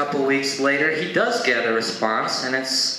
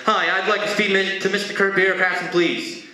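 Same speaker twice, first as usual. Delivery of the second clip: hurried, higher-pitched irritated request; a little pissed; 6 semitones higher than usual. Retold or imitated. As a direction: rush the polite words with mild annoyance. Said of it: "this time he's a little pissed".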